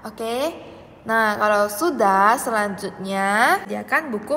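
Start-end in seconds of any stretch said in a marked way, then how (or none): none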